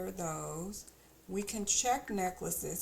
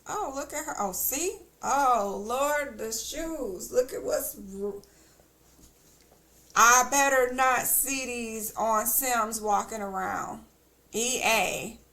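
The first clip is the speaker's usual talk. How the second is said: real outraged yelling, much louder; loud mad rant; 3 semitones higher, 10 dB louder than usual. Real, not acted. Gamer yelling at ugly Sim shoes, real loud anger. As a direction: shout it out, genuinely fed up with the game. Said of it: "I'm getting too loud, but I'm mad".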